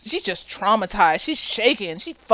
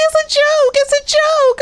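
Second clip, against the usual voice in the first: very high-pitched mock excuse; mocking imitation; 4 dB louder than usual. Imitating Nate excusing his gun prank, mocking. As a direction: squeak it out twice, making fun of him.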